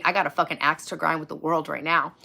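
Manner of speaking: ordinary talk, nothing marked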